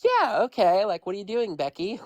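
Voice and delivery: high-pitched mock sorority-girl voice; exaggerated sorority-girl impression. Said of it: "this girl's a sorority girl".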